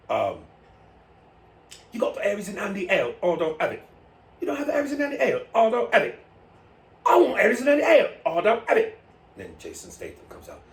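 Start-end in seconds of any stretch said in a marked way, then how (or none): 1.9-9.0 high, loud, quick hammed-up back-and-forth; mock commercial skit. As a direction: play both sides of a bar skit. Acted bar commercial exchange.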